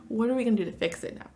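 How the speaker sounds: ordinary talk, nothing marked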